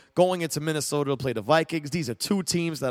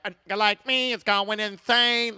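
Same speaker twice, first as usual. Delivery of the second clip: higher-pitched imitation of a piercing rap voice; B-Real; 5 semitones higher than usual. Host imitating the rapper's verse, piercing rap impression. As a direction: mimic his rap delivery, pitched up. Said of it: "when he raps".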